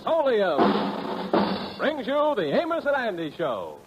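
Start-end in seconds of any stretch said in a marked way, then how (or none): none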